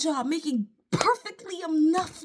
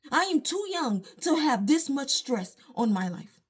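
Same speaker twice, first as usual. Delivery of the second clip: normal talking voice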